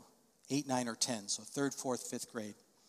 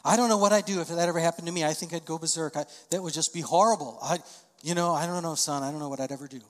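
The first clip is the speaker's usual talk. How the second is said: anxious parent; 3 semitones higher, 7 dB louder, faster than usual. Shown as a demonstration. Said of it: "you could say".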